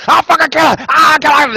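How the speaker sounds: high, loud raving street crazy; crazy street guy. Retold or imitated. Guest imitating crazy guy from Taxi Driver, raving loud and high. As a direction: rave like the neighborhood lunatic. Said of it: "the crazy guy".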